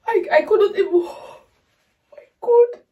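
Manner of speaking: high, breaking voice; words crack with tears; real emotional crack; tearful break. Real, not acted. Overcome, crying at song.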